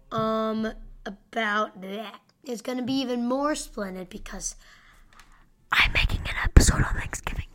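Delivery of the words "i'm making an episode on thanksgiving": loud close-up stage whisper. Pretend. Host teasing listeners about a holiday episode, whispered. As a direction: whisper it right into the mic. Sudden whispered repeat of his announcement.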